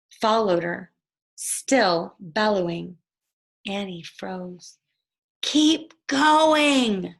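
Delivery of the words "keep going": usual speaking voice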